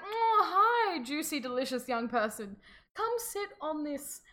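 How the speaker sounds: high, drawn-out coaxing; luring witch. Acted. Witch luring her prey.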